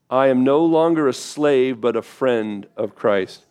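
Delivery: slow, measured recitation with the congregation; slow group reading. Shown as a demonstration. Pastor leading the church in slow recitation. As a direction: read each word slowly with them. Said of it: "I'd like us to read those with me".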